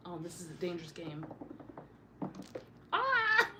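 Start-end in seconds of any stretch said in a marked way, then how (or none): 2.9-3.6 high sharp yelp into a laugh; yelp, then laugh it off; startled yelp. Real, not acted. Cat scratches, startled cry.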